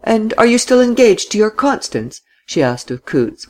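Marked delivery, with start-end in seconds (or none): 0.0-2.2 high-pitched, mildly mocking question; arch, teasing inquiry in a raised pitch; touch of mockery. A narrator's reading. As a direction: needle him lightly, pitched up. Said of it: "with a touch of mockery this time".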